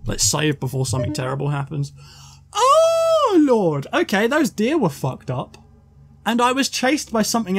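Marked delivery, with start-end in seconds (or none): 2.5-3.9 high shocked cry; startled shout. Real, not acted. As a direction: yelp it out at a jump scare. Sudden jump scare.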